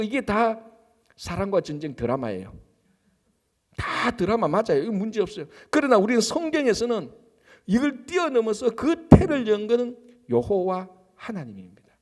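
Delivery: ordinary delivery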